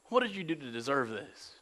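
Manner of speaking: lightly voiced judgmental friend; accusing friend. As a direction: judge him quietly.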